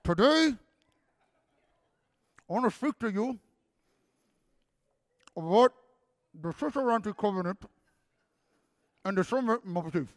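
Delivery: halting, muffled formal address with long gaps; slurred swollen-lip speech. Retold or imitated. Speaker replays his presentation to teachers on Benadryl, slurred swollen-lip speech. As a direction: present groggily through a fat, puffy lip. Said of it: "Like that".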